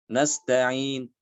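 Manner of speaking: Nasta'in with only a faint, quick trace of u after the n; raum ending. Shown as a demonstration. Teacher demonstrating raum, a shortened vowel. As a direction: barely whisper the u after Nasta'in. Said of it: "dengan cara ar-raum".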